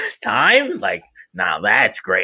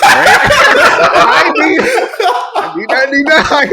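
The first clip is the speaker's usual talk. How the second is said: much higher, louder, laughing plea; 10 semitones higher, 6 dB louder, much slower than usual. Real, not acted. Laughing and pleading.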